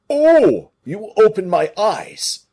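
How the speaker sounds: a giant suddenly grasping the plot; stunned. A narrator's reading. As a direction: gasp it out in shock. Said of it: "stunned by the insinuation".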